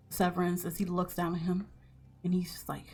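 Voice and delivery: normal talking voice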